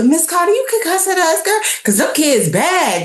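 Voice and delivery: high, eager student voice; a light mimic of kids telling on classmates; imitating her students. Students urging their teacher to cuss, high and eager. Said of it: "they'd be quick to tell".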